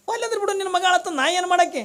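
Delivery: very high, fast acted wife voice; comic high-pitched spousal comeback; exasperated wife. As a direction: rattle off the retort in a high pitch. Wife's retort to husband, repeated.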